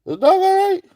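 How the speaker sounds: imitating his own panicked high voice; worried retold question. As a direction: ask high and urgent if the dog is fine. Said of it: "I said".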